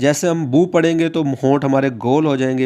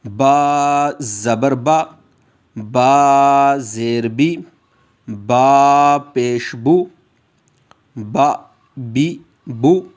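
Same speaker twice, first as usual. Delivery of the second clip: very slow, drawn-out lesson chant; teacher chanting the letters slowly for the class; slow drill recitation; much slower than usual. Shown as a demonstration. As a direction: stretch बा, बी, बू for students to echo. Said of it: "आप मेरे साथ दोहराएंगे".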